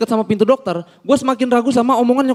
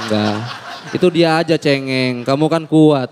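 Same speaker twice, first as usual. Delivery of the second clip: mom reassuring; 8 semitones lower than usual. Retold or imitated. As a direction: lower mom voice insisting. Mother reassuring son. (suara ibu yang menenangkan)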